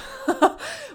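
quick high laugh. Real, not acted. To listeners, admitting they're too tired, a high laugh. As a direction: laugh it off, a little embarrassed.

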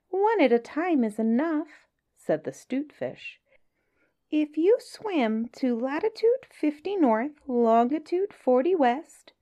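high, sly little fish voice; small astute voice. Acted. Small clever fish flatters the whale, high sly voice. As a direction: sweet-talk the whale in a tiny clever voice. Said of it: "said the 'stute fish".

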